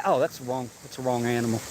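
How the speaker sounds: sheepish low mumble; quiet aside. Real, not acted. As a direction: mutter it to yourself. Embarrassed aside after bleat.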